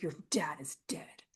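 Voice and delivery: whispered, melodramatic death announcement; hushed bad news. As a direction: break it to her in a mock-grave whisper. Retold breaking of bad news.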